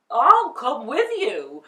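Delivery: a lion's eager growl. Acted. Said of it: "he growled".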